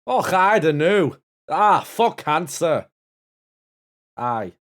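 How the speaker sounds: loud, hammed-up Scottish brogue with rolled burr; over-the-top Scottish accent, loud and slow. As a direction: play a broad Scotsman, slow and booming. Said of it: "in your best Scottish accent".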